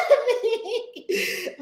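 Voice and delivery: ordinary delivery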